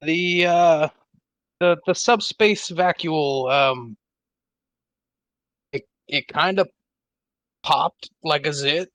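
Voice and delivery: baffled and unsure how to put it; confused. Acted. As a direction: grope for the words, with long halting pauses. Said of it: "in a very confused voice say".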